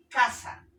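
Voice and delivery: Casa with a loud, high CA; stress on CA. Shown as a demonstration. Teacher giving an example, Casa stressed on CA. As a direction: punch the first syllable CA in Casa. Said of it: "la fuerza de voz está en K".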